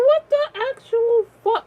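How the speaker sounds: very high, loud, stretched-out disbelief; hammed-up high-pitched shock; shocked outburst. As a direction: squeal it slowly in stunned outrage. Acting out shocked reaction to wife.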